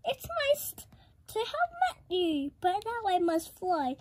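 high-pitched frightened dragon; spluttering. A narrator's reading. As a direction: squeak out hurried excuses to get away. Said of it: "he spluttered".

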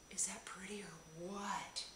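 delighted whisper. Real, not acted. Admiring the pretty case, whispering. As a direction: whisper it with wonder.

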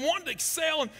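ordinary delivery

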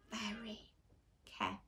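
very soft, dropping whisper; hushed. Acted. Reader closing the book on the eggs, hushed. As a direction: close the book ever so gently.

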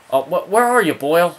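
loud, high-pitched call to a player; sudden frustrated yelp at the game; exasperated outburst. Real, not acted. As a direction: cry out in disbelief at a teammate. Reacting to teammate out of position.

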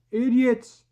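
high, loud hollered insult; yelling. A narrator's reading. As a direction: yell it across a great distance. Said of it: "he yelled".

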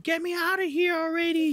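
high, put-on baby voice demanding out; impatient baby. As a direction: play the eager baby begging to be born. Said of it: "I'm like".

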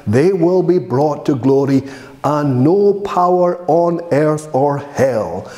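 ordinary delivery

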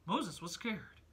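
normal talking voice

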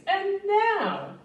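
high, eager call to start the fun; excited cry. Acted. Max calls the wild things to start the rumpus, eager high cry. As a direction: lift it high like a boy king giving the signal. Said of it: "cried Max".

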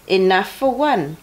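plain reading voice

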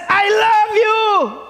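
love drawn out slowly, lifted high; slow and tender, lean into love; God speaking tenderly. Acted. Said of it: "He says".

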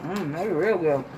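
talking between bites; chewing while talking. Real, not acted. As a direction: mouth partly full. Seen 1: Puff put in mouth at -1.0; jaw working while talking after.

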